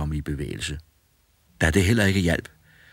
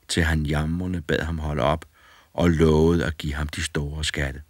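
plain reading voice

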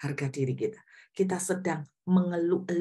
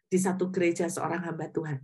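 ordinary talk

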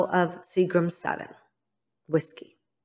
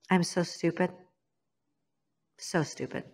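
self-reproach; 9 dB quieter than usual. Real, not acted. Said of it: "self-reproach, fading".

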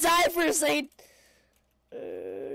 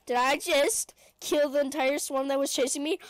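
usual speaking voice

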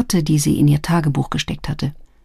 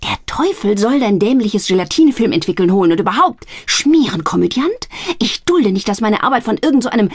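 furious; 4 semitones higher than usual. Acted. Sister furious at being mocked. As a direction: spit it out.

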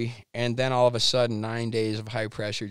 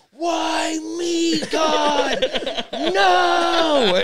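very high, loud wail at the sky with a laugh in it; desperate shout; 7 dB louder, much slower than usual. Retold or imitated. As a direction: howl at God in comic despair. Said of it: "I just start going".

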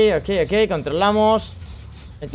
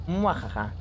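mustache twirling villain; 7 semitones lower, 12 dB quieter, slower than usual. Pretend. Gamer fakes villain laugh.

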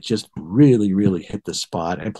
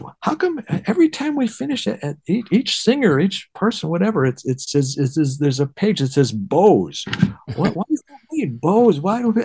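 much higher, puzzled rush of questions; mock-naive bewilderment at the bows page; baffled newcomer; 9 semitones higher than usual. Green young bassist puzzling over bows on the page, high and baffled. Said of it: "I was like".

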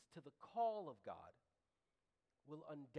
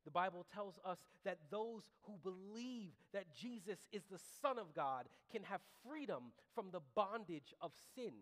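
ordinary talk

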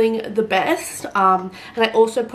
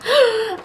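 excited gasp; 14 semitones higher, 5 dB louder than usual. Real, not acted.

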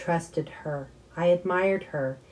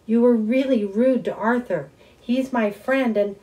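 loud, high-pitched indignant protest; angry protest; 6 semitones higher, 6 dB louder than usual. A narrator's reading. Son protesting to mom about Arthur, loud and indignant. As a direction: defend your friend hotly.

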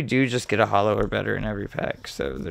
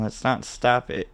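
ordinary delivery

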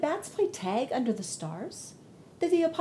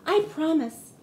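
higher, louder little voice; earnest bunny promise; 7 semitones higher, 6 dB louder, slower than usual. Acted. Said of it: "Thumper said".

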